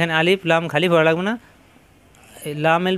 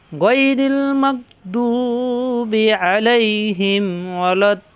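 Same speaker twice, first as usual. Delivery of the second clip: Quran recitation; 3 semitones higher, much slower than usual. Shown as a demonstration. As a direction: sing out the verse with long stretches. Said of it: "teacher recites the verse as model".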